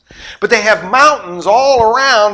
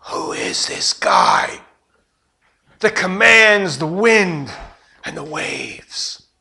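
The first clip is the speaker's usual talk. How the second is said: awed whisper; 4 dB quieter, slower than usual. Retold or imitated. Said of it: "start talking amongst themselves".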